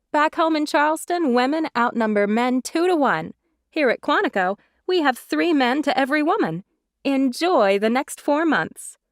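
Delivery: put-on bright Southern girl joking with new friends; Jenna's bubbly voice. A narrator's reading.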